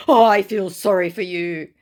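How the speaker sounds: put-on, patronising scammer voice; mock condescending scammer. Mocking the scammer's condescending reply.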